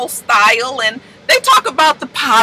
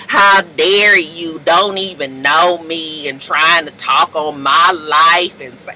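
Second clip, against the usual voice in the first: low, slow, offended lightly voiced [name]; a slow, low, cold rebuke; 8 semitones lower, much slower than usual. [name] scolding the uninvited guest, slow and low.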